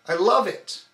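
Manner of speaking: I love it with a hard hit on love; stress on love. Shown as a demonstration. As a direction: squeeze the stomach on love. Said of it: "only on love".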